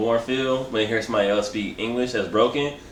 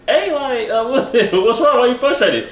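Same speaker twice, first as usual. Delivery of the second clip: higher-pitched mock concern; Fred; 12 semitones higher than usual. Friend asking what upset him, in a high imitating voice. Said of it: "Fred was like".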